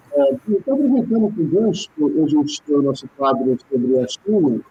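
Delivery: muffled, distant mic; muffled audio. Real, not acted. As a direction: sound muffled like a bad connection. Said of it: "Seu som tá ruim, ... tá abafado".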